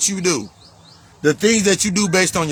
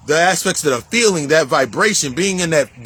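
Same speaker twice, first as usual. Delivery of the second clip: ordinary talk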